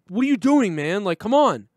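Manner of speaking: acting out a friend's loud, high exasperation; imitated angry outburst. Friend scolding over crumbs on the couch, mock angry. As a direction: snap it like a fed-up friend, retold. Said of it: "he got angry".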